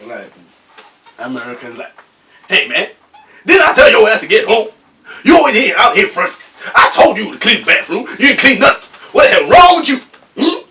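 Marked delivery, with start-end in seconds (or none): 2.5-10.7 loud, high, fast angry telling-off; hammed-up furious American parent yelling. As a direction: scold a kid for not cleaning the bathroom.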